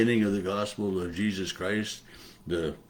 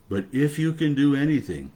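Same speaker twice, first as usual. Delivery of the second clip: raised, louder quoted plea; father's plea; 6 semitones higher, 6 dB louder than usual. Retold or imitated. A father asks Jesus to help his boy, mildly pleading.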